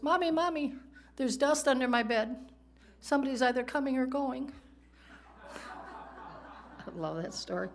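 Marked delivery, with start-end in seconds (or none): 0.0-4.5 put-on high child voice, worried; a scared little boy telling his mom; upset little boy. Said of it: "And he goes". 5.4-6.8 soft chuckle after the punchline; quiet breathy laugh. Real, not acted. Laughs at own joke.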